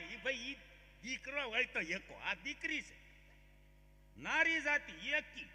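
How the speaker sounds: bride's mother insisting, slow at the end; mock indignant mother. Bride's mother corrects the groom's father, lightly mock-indignant. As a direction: correct him with fake certainty. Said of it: "ઈ કે".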